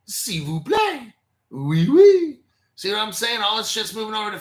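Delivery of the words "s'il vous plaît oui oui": hammed-up French, slow then a loud high "oui, oui"; jokey fake French; mock French accent. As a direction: camp it up like a cartoon Frenchman. Mocking two French guys.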